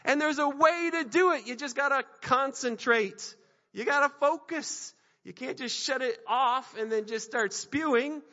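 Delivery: loud, high, driving sermon voice; preaching at full force. Real, not acted. Pastor urging the congregation, loud driving sermon. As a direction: push the point hard to the congregation.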